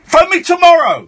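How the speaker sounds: impatient command; exasperated dismissal. Real, not acted. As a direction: send a nagging caller away. Host dismisses the caller.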